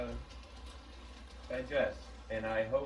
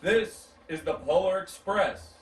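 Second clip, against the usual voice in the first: loud, higher announcement; proud conductor; 8 semitones higher, 9 dB louder than usual. Acted. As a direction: present the train with pride. Conductor names the train.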